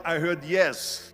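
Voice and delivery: ordinary delivery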